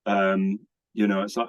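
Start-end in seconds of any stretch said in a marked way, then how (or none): none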